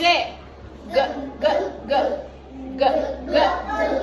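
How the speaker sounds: "G" as a hard "guh", not the letter name; letter-sound G. Shown as a demonstration. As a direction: punch out each "G" as a brief hard g. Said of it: "Qual é o som da letra G".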